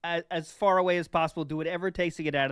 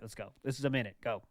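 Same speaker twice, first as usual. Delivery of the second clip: ordinary talk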